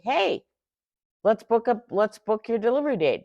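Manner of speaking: chirpy customer-service pitch, played up; mock cheerful rep. Company rep chirping to customer, mock cheerful. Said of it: "they say".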